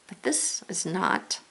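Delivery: normal talking voice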